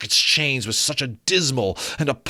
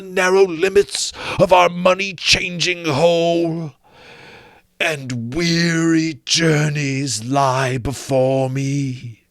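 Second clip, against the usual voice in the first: ghost lamenting; 6 semitones higher, 4 dB louder than usual. Acted. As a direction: mourn it like a doomed spirit.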